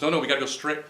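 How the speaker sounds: firm loud no, no; insistent call. Retold or imitated. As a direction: stop her from turning the wrong way. Insisting on the road in the car.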